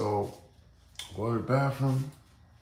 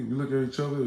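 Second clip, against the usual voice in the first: ordinary talk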